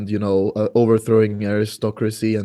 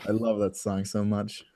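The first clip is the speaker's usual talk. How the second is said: ordinary delivery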